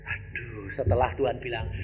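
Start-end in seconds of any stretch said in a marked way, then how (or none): none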